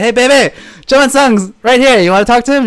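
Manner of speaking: put-on eager boyfriend calling his girlfriend on the phone; excited phone call. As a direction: tell her the news, all worked up.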